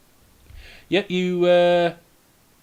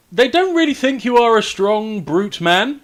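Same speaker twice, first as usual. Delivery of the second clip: high, louder mock boast; raised, projected ribbing; mocking echo; 8 semitones higher, 5 dB louder than usual. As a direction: teasingly parrot the boast back, pitched up. GM echoes player's in-character boast.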